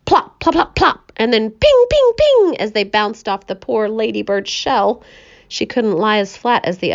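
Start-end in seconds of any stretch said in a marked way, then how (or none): none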